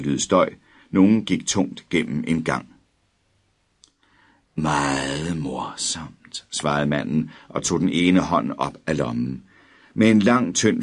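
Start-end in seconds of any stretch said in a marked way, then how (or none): 4.6-6.5 slow, lower, softer mocking; dry sarcasm. A narrator's reading. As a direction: drawl it out sarcastically. Figure mocks boy. (langsomt, lavt og hånligt)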